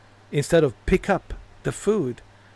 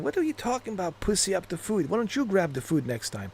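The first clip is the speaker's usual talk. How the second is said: higher, softer imitation of a complaint; mock indignant friend; 4 semitones higher, 6 dB quieter than usual. Friend arriving with the food, mock indignant. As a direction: lightly play the put-out friend.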